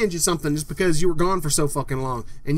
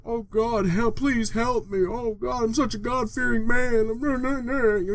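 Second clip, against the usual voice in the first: mock pious plea; 5 semitones higher, slower than usual. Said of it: "You'll post it".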